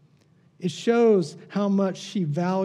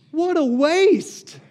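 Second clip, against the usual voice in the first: 1 dismayed; 11 semitones higher, 8 dB louder, much slower than usual. Acted. Lamenting wasted perfume.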